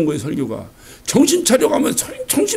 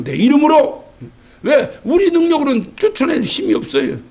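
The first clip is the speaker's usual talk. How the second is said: ordinary talk, nothing marked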